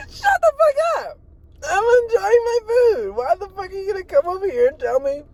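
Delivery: very high-pitched hammed-up squawk of protest; comic falsetto outburst at an interrupter; mock tantrum. Acts out snapping at the food critic.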